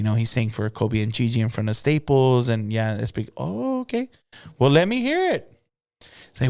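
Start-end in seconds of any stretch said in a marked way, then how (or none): 3.4-5.5 much higher, bright lady voice for the boss; Boss Lady's voice. Retold or imitated. Boss Lady greeting Fred and the new singer, in a much higher lady voice. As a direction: play the gracious lady boss greeting the room.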